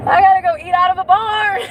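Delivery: high, laughing through the words; can't believe she has to eat at a barn; laughing disbelief. Real, not acted. Driving in a snowstorm, laughing to her viewers.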